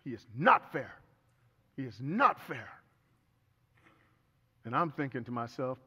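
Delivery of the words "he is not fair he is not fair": imitating her, punching NOT loudly; mock vehement agreement, hard stress on NOT; emphatic agreement. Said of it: "immediately she starts to say".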